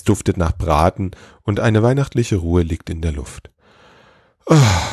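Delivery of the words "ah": loud, worn-out sigh; exhausted sigh. A narrator's reading. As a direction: let out a heavy sigh of exhaustion. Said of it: "Erschöpft lässt sich".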